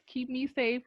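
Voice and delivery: ordinary talk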